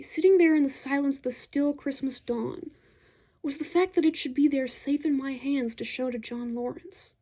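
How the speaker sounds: ordinary delivery, nothing marked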